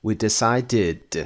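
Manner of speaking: "decided" ending in a full id syllable; very slow "decided", sounded decide-id. Shown as a demonstration. Teacher giving an example, "decided" with id ending.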